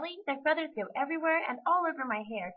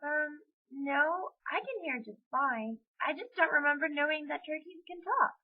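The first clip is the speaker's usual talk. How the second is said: higher-pitched hesitant voice; careful; 6 semitones higher than usual. A narrator's reading. Amy answering the talking turkey, careful. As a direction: pick the words carefully. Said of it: "Amy said in a careful voice".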